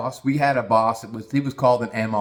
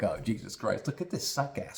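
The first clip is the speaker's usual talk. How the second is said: low-volume aside under the breath; muttered disgust; 3 semitones lower, 10 dB quieter than usual. Retold or imitated. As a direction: imitate a weary boss muttering to himself. Boss's private disgusted aside, imagined.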